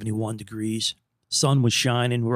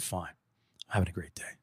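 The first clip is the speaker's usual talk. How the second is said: soft whispered aside; whispered reply; 5 semitones lower, 11 dB quieter than usual. Retold or imitated. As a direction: breathe it out as a whisper. Answering the cashier.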